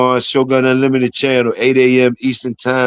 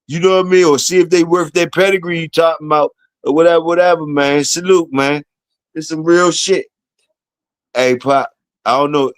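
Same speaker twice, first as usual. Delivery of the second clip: usual speaking voice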